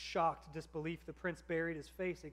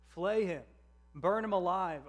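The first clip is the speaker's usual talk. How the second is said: loud, higher shout from the crowd; 4 semitones higher, 9 dB louder than usual. A narrator's reading. Crowd shouting at the prince, a loud angry cry. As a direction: cry out for his punishment, loud. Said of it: "other voices shouted".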